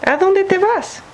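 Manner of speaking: put-on talking to a toddler. Toddler wanders off.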